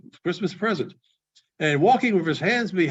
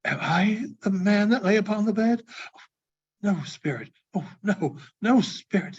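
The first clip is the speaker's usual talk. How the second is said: put-on higher, pleading old man; desperate Scrooge; 5 semitones higher than usual. Scrooge pleading with the spirit, rising desperately. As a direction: beg for your life, rising to a cry.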